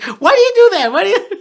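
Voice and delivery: high, mock-exasperated wife; wife asking why he keeps doing it, mock exasperated. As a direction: whine it as the fed-up wife. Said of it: "she's like".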